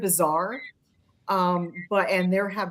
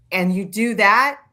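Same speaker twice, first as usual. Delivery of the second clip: usual speaking voice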